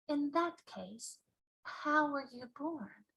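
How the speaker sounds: put-on a puzzled small girl; Sophie's voice. Sophie puzzled by the giant, softly.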